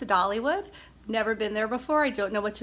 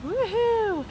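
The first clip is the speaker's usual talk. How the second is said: excited cheer; 10 semitones higher than usual. Real, not acted. Cheering arrival at Dollywood.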